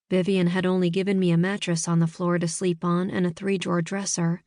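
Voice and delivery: plain reading voice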